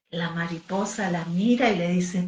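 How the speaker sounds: put-on bright, lively voice reading to little children; storytelling for kids. A narrator's reading. Teacher telling kids the baby bird story, animated storyteller. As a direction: tell it like a bedtime tale for a class.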